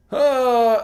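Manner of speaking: high, loud, squeezed ah with too little air; overly tight strained tone; pressed ah. Shown as a demonstration. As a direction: clamp the throat and push the ah. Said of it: "like, that's too much".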